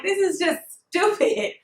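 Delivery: real annoyance, words dragged out slowly; fed up. Real, not acted. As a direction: drop the polite voice and let the irritation out. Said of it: "I cannot use my customer service voice when I get upset".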